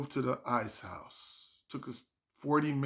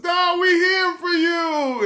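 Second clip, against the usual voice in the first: much higher, loud slow shout; cheering family; 9 dB louder, slower than usual. Retold or imitated. Relatives supporting a nervous comic, loud slow shout.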